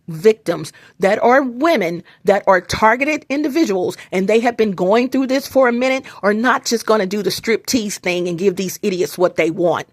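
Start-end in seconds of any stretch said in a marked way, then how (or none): none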